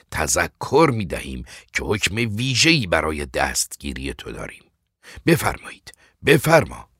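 loud, commanding officer; firm, emphatic orders with pitch leaping up; arresting officer. Acted. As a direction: take charge of the prisoner, loudly.

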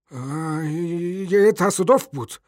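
slow, high, halting stammer. A narrator's reading. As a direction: drag out the first sounds nervously. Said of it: "پیرمرد با لکنت گفت". (با لکنت)

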